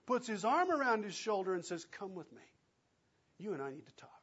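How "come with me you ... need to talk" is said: softer, drawing him close; private aside. Acted. Peter taking Jesus aside, hushed and confidential. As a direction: say it as if just between the two of you.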